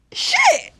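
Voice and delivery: high excited shit. Real, not acted. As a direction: yelp it. High exclaim.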